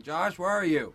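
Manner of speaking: imitated mother's yell across the yards; mom screaming out. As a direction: holler for a kid like a mom. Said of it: "scream out my".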